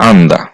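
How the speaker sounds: আন্দা said clearly as a model Korean word; teacher models আন্দা; Korean pronunciation demo. Shown as a demonstration. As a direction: pronounce আন্দা cleanly for the class. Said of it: "এই শব্দটার সঠিক উচ্চারণ হবে".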